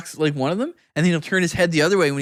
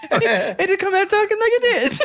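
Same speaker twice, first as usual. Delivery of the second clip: higher-pitched, hammed-up Scottish accent; bad Scottish accent; 14 semitones higher than usual. Friend dared to turn suddenly Scottish, bad mock Scottish accent. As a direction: put on a terrible Scottish accent, half laughing. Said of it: "like, a thick Scottish accent".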